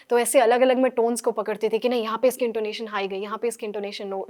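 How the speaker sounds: usual speaking voice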